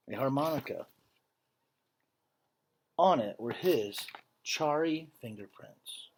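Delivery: earnest, measured read-aloud voice; story narration. A narrator's reading. Teacher reading a picture book to students, earnest storytelling. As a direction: read the story to the class with feeling.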